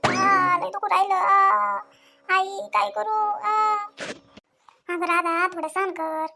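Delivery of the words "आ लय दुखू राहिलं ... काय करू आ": loud pained groans between words, pitch up; labor pain cries. Acted. Pregnant woman in labor groaning to her mother-in-law. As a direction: cry out through the contractions.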